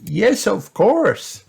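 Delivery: high, slow, drawn-out granny voice; reply slowly in a high-pitched voice; Granny Pig. A narrator's reading. Granny replies to Peppa, high slow granny voice.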